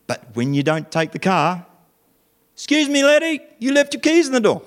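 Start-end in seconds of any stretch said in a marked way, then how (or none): 2.5-4.6 high, light put-on shopkeeper voice; helpful shopkeeper. A shopkeeper tells the customer he left his keys, high and friendly. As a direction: kindly point out the keys in a high voice.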